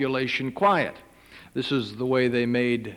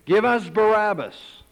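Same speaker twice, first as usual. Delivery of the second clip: loud, higher mob cry; crowd shouting; 8 semitones higher, 5 dB louder, slower than usual. Acted. Crowd shouting back at Pilate, loud and high. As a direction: call it out like an angry crowd. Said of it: "They shouted back".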